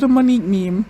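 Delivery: ordinary talk, nothing marked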